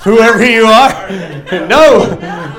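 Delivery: loud, drawn-out comic refusal; hammed-up defiant retort; mock defiance. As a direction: push back at the voice, loud and laughing. Acts out refusing the voice.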